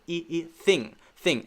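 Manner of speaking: "thing" with a spread, smiling vowel close to E; smiled E-like vowel. Shown as a demonstration. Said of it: "we kind of have to smile a little bit".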